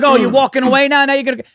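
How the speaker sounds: high, loud sarcastic jab; sarcastic jab at a wife leaving the argument; mock indignant. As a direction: needle her as she walks away. Mocking wife walking away.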